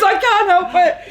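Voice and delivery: high, loud, slow laughing whine; mock helpless protest. Colleague excusing her constant looking up, mock helpless protest.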